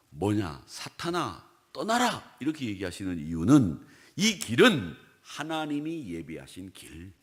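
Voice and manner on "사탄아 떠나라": rising, higher firm rebuke; firm command. Retold or imitated. Jesus firmly sending Satan away, a rising, higher command. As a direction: order Satan away with certainty. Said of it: "확실하게 얘기하시는 거죠".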